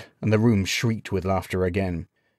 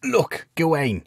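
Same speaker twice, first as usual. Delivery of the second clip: slow, higher, placating start; stifled laughter; 8 semitones higher, slower than usual. A narrator's reading. Sage starts explaining to the knight, amused. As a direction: begin explaining as the mirth settles. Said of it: "stilled his laughter so he could explain".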